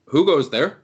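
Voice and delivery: acted guard shout; challenging shout. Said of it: "and they shout out".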